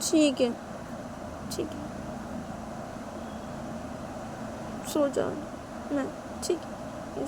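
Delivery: small, quiet, resigned voice; hurt, giving-in Shanaya. Acted. Shanaya agrees to go to sleep, quietly hurt. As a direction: give in softly with long pauses.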